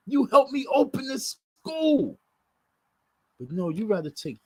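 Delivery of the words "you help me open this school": angry shout. Real, not acted. Shouting at the organiser mid-rant.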